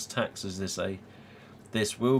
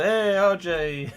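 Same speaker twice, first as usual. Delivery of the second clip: loud, high, drawn-out mock greeting; amused calling out; 9 semitones higher, 5 dB louder, slower than usual. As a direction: stretch it out like hailing a pal. Acts out greeting singing friend.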